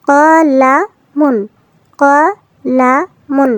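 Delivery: very slow, drawn out word read whole; slow joined reading. Shown as a demonstration. Teacher blending letters into a word for children, very slowly. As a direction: stretch each syllable as you blend the word. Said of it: "মিম দুই পেশ মুন".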